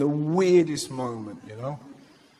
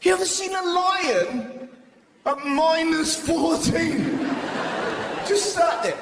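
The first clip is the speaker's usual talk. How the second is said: high, excited shouting setup; 11 semitones higher than usual. Acted. Lion bit, high shouty delivery.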